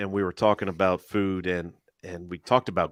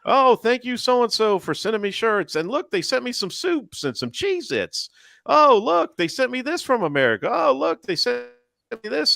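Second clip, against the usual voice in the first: high, louder, thrilled put-on voice; mocking e-beggar unboxing handouts in a high squeal; mock gushing e-beggar; 14 semitones higher, 4 dB louder than usual. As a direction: gush over the gifts like a fake-surprised beggar. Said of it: "a couple videos later".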